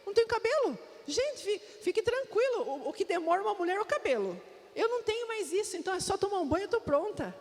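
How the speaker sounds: excited reply. Retold or imitated. Wife telling husband she needs no prep, excited high. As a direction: pitch it way up and sell the joke. Retelling her cheerful careca reply.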